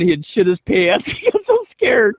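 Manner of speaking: high, breaking into laughter; real laughter. Real, not acted. Father telling of his scared son, cracking up. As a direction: laugh through the words, voice climbing. Teller cracks up over story.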